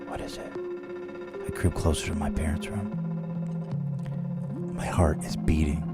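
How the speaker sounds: ordinary talk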